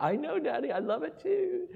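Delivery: put-on very high, sweet child's voice; little girl's voice. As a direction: pipe it up like a small daughter.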